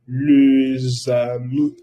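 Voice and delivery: les amis slowly with a z link; slow liaison demo. Shown as a demonstration. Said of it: "O también". (les amis despacio, con zeta)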